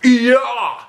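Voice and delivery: loud, high crazy shout; wild yell. Acted. Teacher acting crazy, loud high yell. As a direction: holler like a madman. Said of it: "started screaming".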